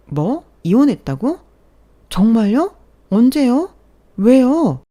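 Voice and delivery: high, loud shocked questions; shocked coworkers. Acted. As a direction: fire off stunned questions one after another. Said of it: "일제히 놀라면서 질문을 쏟아내더라고요".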